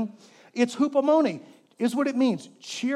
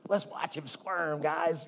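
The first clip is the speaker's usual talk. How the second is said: sinister devil; 5 semitones lower, 7 dB quieter, slower than usual. Pretend. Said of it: "He might have a magnifying".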